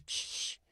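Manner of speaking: soft unvoiced shh like water spraying from a hose; hose spray hiss. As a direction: imitate the thumb-on-hose hiss, breathy and quiet. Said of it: "it goes".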